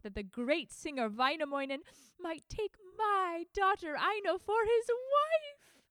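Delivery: mother overjoyed at her son's news. Acted. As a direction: crow with delight at the match. Mother rejoices.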